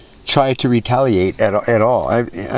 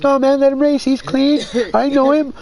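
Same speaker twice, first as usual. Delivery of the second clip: mock vouching friend; 13 semitones higher than usual. Friend vouching for a suspect racer, high mock voice. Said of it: "He's like".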